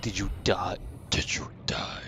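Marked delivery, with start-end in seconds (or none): 1.1-2.0 voiceless playful whisper; hushed, whispered check; whispered question. Pretend. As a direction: whisper it to a fallen opponent. Whispers question to beaten dog.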